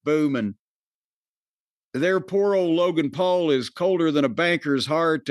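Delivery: ordinary delivery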